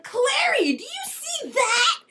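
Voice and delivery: high, loud, slow call with a laugh; a high, excited, giggling call. Acted. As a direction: shout it in mock awe. Jace calling out in awe.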